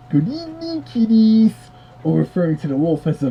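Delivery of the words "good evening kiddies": higher, mock storybook narrator greeting; Robbie Lester greeting. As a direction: lightly ham up a kiddie-record host. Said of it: "Robbie Lester saying".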